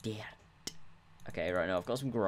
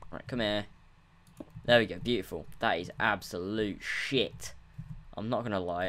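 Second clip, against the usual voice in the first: ordinary talk, nothing marked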